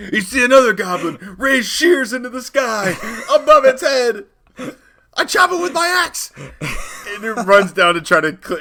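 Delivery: very high, loud yelling through laughter; mock-heroic shouting at a goblin with shears; hammed-up shout. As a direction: scream it like a panicked adventurer. Hammed-up adventurer panic.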